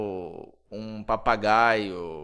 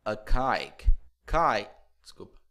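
ordinary talk, nothing marked

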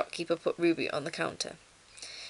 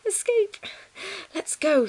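high, loud whoop to go; rally everyone for the breakout; excited rallying cry; 14 semitones higher, 5 dB louder than usual. A narrator's reading. Ruby leading the bears out, excited rallying cry.

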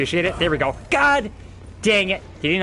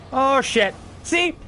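usual speaking voice

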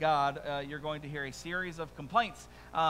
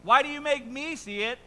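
raised, insistent questioning; protesting; 8 semitones higher, 7 dB louder than usual. Acted. Habakkuk questioning God over evil, protesting. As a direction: press the question loudly toward heaven. Repeats Habakkuk's complaint aloud.

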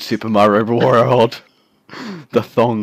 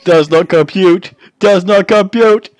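put-on old speech synthesizer; 6 semitones higher, 4 dB louder than usual. Robot catchphrase repeated.

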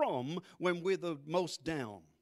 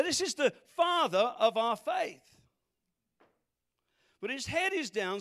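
louder, impassioned preaching build; 4 semitones higher, 6 dB louder than usual. Acted. Pastor preaching Abraham's loneliness to the congregation, impassioned. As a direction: drive the point home to the congregation.

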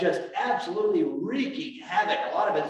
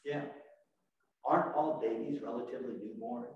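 normal talking voice

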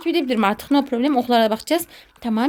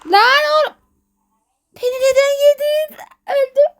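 anguished yelling; 9 semitones higher than usual. Real, not acted. Player just swallowed by the monster, high protesting wail. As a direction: wail in outrage at being eaten.

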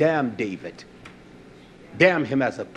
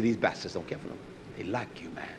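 faster, softer version of the manager's words; quick, quieter retelling of the boss; 6 semitones lower, 11 dB quieter, faster than usual. Retold or imitated. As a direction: rattle it off quietly. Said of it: "He said".

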